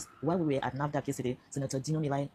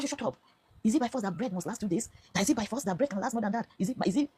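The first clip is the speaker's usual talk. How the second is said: higher-pitched retort, rapid-fire questions; retold angry scolding; 7 semitones higher, 3 dB louder than usual. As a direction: mimic someone telling you to shut up. Retells being angrily told off.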